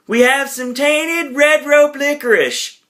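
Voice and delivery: high, slow put-on announcement; [name] announcing. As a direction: call it out like a goofy festival announcer. Acted announcement by [name] to crowd.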